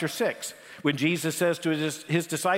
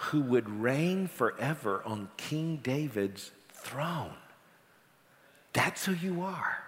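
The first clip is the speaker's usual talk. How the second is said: very slow, softer, every phrase drawn out; reverent hush; 4 semitones lower, 5 dB quieter, slower than usual. Acted. Preacher voicing Peter's awed words to Jesus, slow and hushed. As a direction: let it land like a sacred secret.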